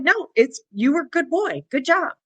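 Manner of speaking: put-on sweet praise for a good boy; praising the dog. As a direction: reassure the dog gently. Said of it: "And I was like".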